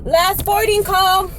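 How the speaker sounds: slow, high drawn-out announcement; intercom announcer. Retold or imitated. Gate agent calling the flight over intercom, announcing. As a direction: stretch it out like a boarding call. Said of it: "This guy on the intercom calls".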